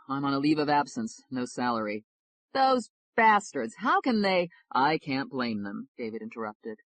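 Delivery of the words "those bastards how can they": indignant, higher and louder, slow on the curse; outraged. Acted. Friend outraged on David's behalf, indignant. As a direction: spit out the insult in disbelief.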